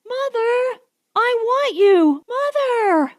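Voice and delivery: put-on high little boy's shriek, loud on want; child shrieking. A narrator's reading. Bobbity shrieks for his mother through the door, high child's shriek. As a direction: shriek for your mother like a toddler. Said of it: "shrieked Bobbity".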